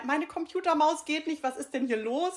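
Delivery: amused re-enactment of mild computer frustration; mock baffled complaint. Acts out puzzled mouse user.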